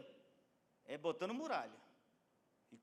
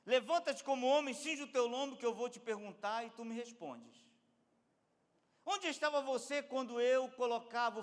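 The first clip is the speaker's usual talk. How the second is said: raised, forceful questioning; commanding challenge; 7 semitones higher, 8 dB louder than usual. Acted. God confronting Job with questions, commanding. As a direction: challenge the listener with authority.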